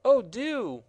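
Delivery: put-on excited girl crying out; eager cry. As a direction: beg eagerly like a child. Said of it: "she cried".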